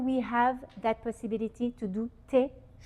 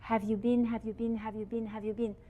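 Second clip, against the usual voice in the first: fading repetition; 7 dB quieter than usual. Acted. Repeated question fading away.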